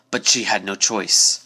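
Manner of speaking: plain reading voice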